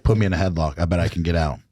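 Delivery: ordinary talk, nothing marked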